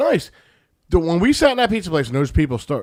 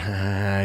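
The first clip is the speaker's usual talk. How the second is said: low, softer mock laugh; imitated goofy laugh; 9 semitones lower, 5 dB quieter than usual. Storyteller mimics the kid laughing at him, goofy laugh. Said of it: "he's laughing in this goofy laugh".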